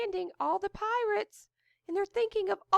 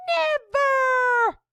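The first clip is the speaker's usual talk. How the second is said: high loud ringing cry; thundered; 8 semitones higher, 8 dB louder than usual. A narrator's reading. The wizard thunders her refusal at the captain, loud. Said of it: "thundered the wizard".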